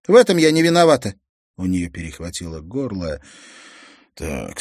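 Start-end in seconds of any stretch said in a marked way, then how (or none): 0.1-1.1 defensive cry. Acted. Girl chokes up defending herself.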